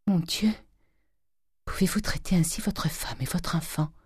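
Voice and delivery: faint, whispery reproach; weak voice. A narrator's reading. Ailing wife reproaching her husband, weak voice. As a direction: speak softly, with barely any strength. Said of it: "dit d'une voix faible".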